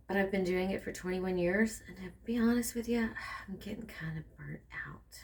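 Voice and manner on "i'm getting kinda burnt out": breathy whisper, very soft. Real, not acted. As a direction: let it slip out in a whisper. Confessing burnout in a whisper.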